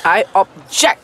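"object" stressed on the second syllable, JECT. Shown as a demonstration. Teacher shows students where to press, "object" stressed on JECT. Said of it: "dia tekan sikit on the jet".

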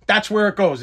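usual speaking voice

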